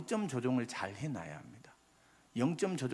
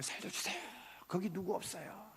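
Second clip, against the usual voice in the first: urgent cry for help; 7 semitones higher, 9 dB quieter than usual. Retold or imitated. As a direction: cry out for rescue as if sinking in the water. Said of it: "다급하게 얘기하죠".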